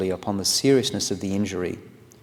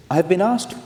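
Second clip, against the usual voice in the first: put-on a quick, much higher stab at Lord Lyndhurst's accent, dropped at once; attempted accent; 10 semitones higher, 4 dB louder than usual. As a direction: start the quote in a put-on accent, then give up. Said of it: "I can't do the accent".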